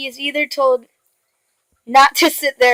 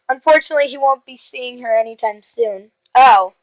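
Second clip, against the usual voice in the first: ordinary delivery, nothing marked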